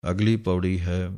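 ordinary delivery

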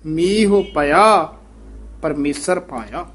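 ਮੀਹ ਪਿਆ very slow with a pause after it; slow demonstration. Shown as a demonstration. Teacher slowly models ਮੀਹ ਪਿਆ. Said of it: "ਹਾਂਜੀ, ਮੀਹ".

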